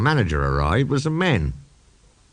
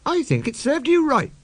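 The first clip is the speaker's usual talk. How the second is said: put-on high, prim girl voice, severe; Nancy chiding Skarloey severely. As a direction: scold him like a strict little girl. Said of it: "said Nancy severely".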